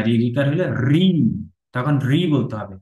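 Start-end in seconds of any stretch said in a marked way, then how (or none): 0.0-0.1 রি with a rolled r and held vowel; long রি. Shown as a demonstration. Teacher showing the doubled letter, long রি. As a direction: draw out the vowel of রি. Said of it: "আবার double রি হয়".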